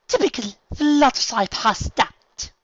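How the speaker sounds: high, loud, slow disdainful voice; sneering Rarity. Acted. Rarity glaring at Fluttershy, loud disdainful drawl. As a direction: look down your nose. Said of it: "glaring at her".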